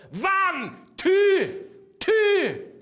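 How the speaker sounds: loud, high mixed-accent count-in; Scottish-German count. Shown as a demonstration. Drummer demonstrating mixed accents, loud and comic. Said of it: "You can mix the Scottish and German up together".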